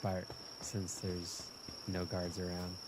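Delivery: usual speaking voice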